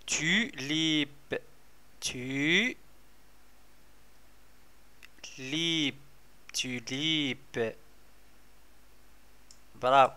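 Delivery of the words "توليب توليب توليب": slow, softer توليب drawn out; teacher modelling توليب softly and slowly; soft sounding out. Shown as a demonstration.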